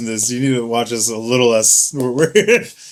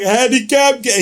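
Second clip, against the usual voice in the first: high-pitched imitation of a party chant; mock rallying cry; 10 semitones higher than usual. Buddy rallying his drinking partner, high mock cry. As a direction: imitate a drunk hype-up call.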